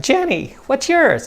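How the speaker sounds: put-on high chirpy girl's voice; bubbly girl. Girl giving her name to chump, high chirpy voice. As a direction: chirp it like a cheerful girl at a bar. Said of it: "the girl will be like".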